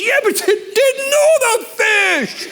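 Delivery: very high, slow, strained yell; husband shouting at his wife over fish, hammed-up outrage; mock angry shout. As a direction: wail it out in comic outrage. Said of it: "And I'm getting mad".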